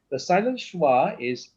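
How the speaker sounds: ordinary talk, nothing marked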